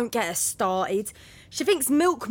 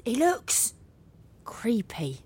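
creeped-out whisper. Acted. Housemate describing the strange man outside, whispering. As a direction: whisper it uneasily.

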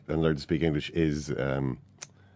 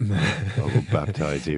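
mock preacher line; much slower than usual.

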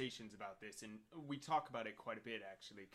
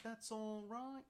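higher-pitched put-on British questioning lilt; exaggerated British "That's all right?"; mock British accent; 7 semitones higher, 4 dB quieter, slower than usual. Recreating the remark friend heard, mock British accent. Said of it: "That sounded so British".

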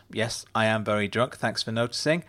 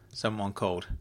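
usual speaking voice

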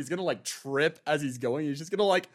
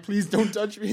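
higher-pitched, nervous little plea; playfully anxious, raised voice; mock pleading; 7 semitones higher, 3 dB louder than usual. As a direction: beg lightly to be left alone. Huron's plea, acted.

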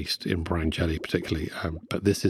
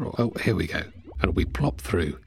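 ordinary talk, nothing marked

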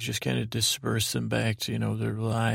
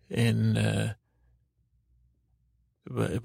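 usual speaking voice

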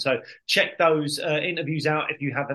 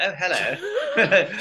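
slow, higher mock-posh "Oh, hello" through a laugh; 5 semitones higher, 3 dB louder, much slower than usual. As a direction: ham up a plummy gentleman greeting. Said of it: "you were like".

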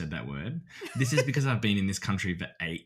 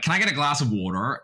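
higher, louder mock American order; put-on American accent; 6 semitones higher, 6 dB louder than usual. Australian friend orders water, mock American accent. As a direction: switch to a hammed-up American twang. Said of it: "one of them will be like".

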